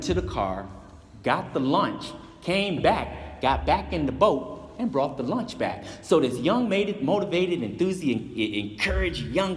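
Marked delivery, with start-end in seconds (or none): none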